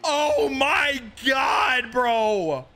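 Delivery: very high, drawn-out wail, coming down; exasperated yelling. Real, not acted. Streamer fed up with the lagging game, stretched high wail. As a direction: wail it slowly in disbelief.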